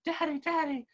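put-on high, squeaky child asking; little kid voice. Cartoon kid asks dad at the zoo, high and squeaky. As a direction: pipe up like a curious little kid. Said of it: "the kids are at the monkeys and they're like".

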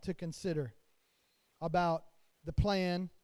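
normal talking voice

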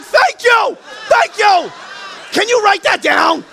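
high, exultant shout; acting out loud, overjoyed relief; jubilant husband. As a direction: shout it high, utterly vindicated. Husband jubilant at doctor's diagnosis.

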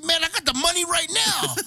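high, loud mock boast, nearly laughing; cocky retort. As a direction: toss it back high and loud, proud of the cash. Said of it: "I said".

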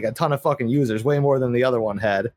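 usual speaking voice

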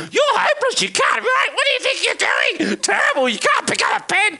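high, sneering put-down voice; shrill scornful critic; mock critic. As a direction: jeer like a harsh critic. Mocking critics' harsh voice.

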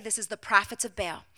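ordinary talk, nothing marked

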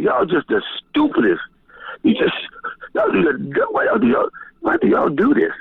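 exasperated scolding pitched high, stammering; retold scolding. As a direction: berate them in disbelief, voice jumping up. Quoting himself cussing people out.

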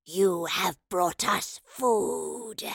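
very slow, rasping monster voice; gargling wraith. A narrator's reading. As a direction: drag out each word like a hungry wraith. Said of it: "the voice gargled".

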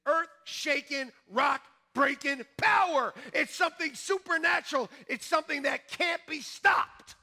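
high, loud, fervent preaching shout; preaching at full force. Real, not acted. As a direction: build to a roaring climax. Preaching climax.

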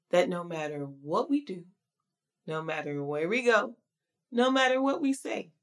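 ordinary talk

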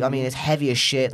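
normal talking voice

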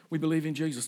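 ordinary talk, nothing marked

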